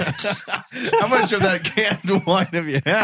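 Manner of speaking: laughing. Real, not acted. Laughing at cohost.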